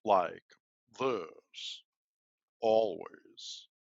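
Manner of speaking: a touch of a booming theater voice; lightly put-on booming voice; imitating the booming guy. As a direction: mimic the regular's voice for a laugh. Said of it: "the guy has a very distinctive voice".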